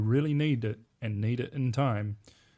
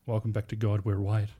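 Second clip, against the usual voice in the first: usual speaking voice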